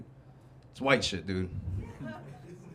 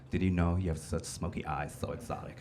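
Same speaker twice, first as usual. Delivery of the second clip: seductive fan; 10 semitones lower, 9 dB quieter than usual. Retold or imitated.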